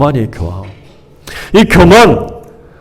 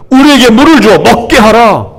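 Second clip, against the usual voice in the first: haughty demand; 6 semitones higher than usual. Retold or imitated. Preacher voices the proud people ordering Moses, haughty demand. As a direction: bark it at Moses like a command. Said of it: "달라는 거".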